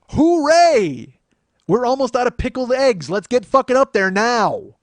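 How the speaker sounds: loud, high whooping crowd cheer; hammed-up rowdy drunks rushing upstairs, last line slow; mock drunk cheer. Drunk patrons cheering at free show.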